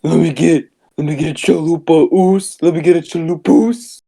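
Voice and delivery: hammed-up fast-food ordering bit; exaggerated Spanish-accent order; mock Taco Bell order.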